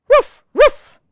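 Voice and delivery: high, loud double woof; puppy woof. Acted. Biscuit answering the reader, high loud puppy woofs. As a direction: yip like an eager little puppy. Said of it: "Can everybody out there go woof".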